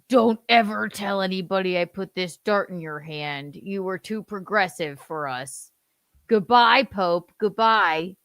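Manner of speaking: high, loud mock JD voice; hammed-up dub voice. Son dubs JD mocking the Pope for his mom. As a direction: lay it on thick as a sneering JD.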